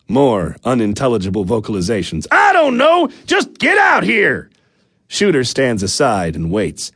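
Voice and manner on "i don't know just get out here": much higher, louder frustrated yell; exasperated shout. Acted. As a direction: shout it out, fed up. Husband shouts back at wife.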